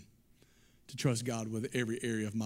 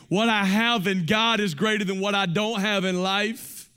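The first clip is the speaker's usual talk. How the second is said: higher and louder, ringing declaration; proclaiming; 8 semitones higher, 10 dB louder, slower than usual. Retold or imitated. Preacher voicing David's conviction, loud proclamation. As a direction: proclaim it like a triumphant truth. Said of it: "it's as if he's saying".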